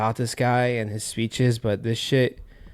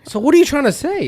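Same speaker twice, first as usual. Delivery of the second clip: challenging; 5 dB louder than usual. Real, not acted. Challenging the rival fan.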